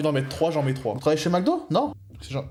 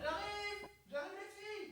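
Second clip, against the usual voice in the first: distant call from another room; calling from afar; 21 dB quieter, slower than usual. Real, not acted. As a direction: shout it from far off down the hall. (appel lointain depuis une autre pièce)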